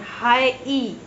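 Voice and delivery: "हाई ई" with the final ई held long; drawn-out ई sound. Shown as a demonstration. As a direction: say "हाई ई", separating out the ee ending. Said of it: "आप इसको बोल के देंगे".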